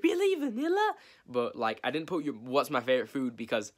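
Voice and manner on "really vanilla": very high, incredulous squeak; mocking a shocked commenter, high and incredulous; mock disbelief. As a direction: play a viewer shocked at vanilla. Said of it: "people are gonna be like".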